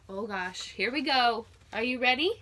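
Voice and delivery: ordinary delivery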